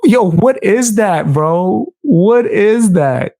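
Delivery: normal talking voice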